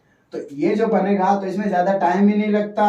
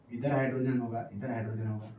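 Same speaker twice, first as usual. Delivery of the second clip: talking to oneself; 8 semitones lower, 13 dB quieter than usual. Real, not acted. Talking to himself while drawing bonds.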